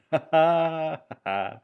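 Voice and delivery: loud, high burst of laughter; real laugh. Real, not acted. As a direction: burst out laughing mid-commentary. Laughs during own commentary.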